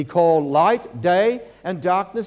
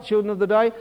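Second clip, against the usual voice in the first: ordinary talk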